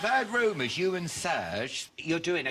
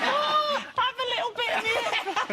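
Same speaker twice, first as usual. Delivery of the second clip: even higher, squealed caricature of a mate; very high, giggly mock offer; Serge. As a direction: repeat the silly high voice through laughter. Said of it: "he does talk like that".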